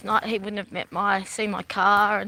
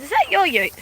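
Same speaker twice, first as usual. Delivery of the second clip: higher, louder mock bloke; imitating a man; 9 semitones higher, 5 dB louder than usual. Man at rehearsal asking about the ute, mock voice. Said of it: "He goes".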